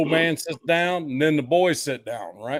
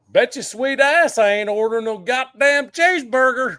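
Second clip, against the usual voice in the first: put-on brash toddler; 6 semitones higher, 4 dB louder than usual. Toddler answers the waitress.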